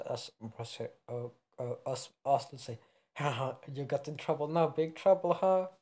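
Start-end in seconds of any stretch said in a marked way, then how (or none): 3.2-5.7 high, loud teasing; gloating sing-out at a friend in trouble; gleeful taunt. Acted. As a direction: tease with a mock laugh, getting louder. Character teases another about trouble.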